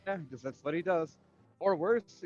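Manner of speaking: ordinary delivery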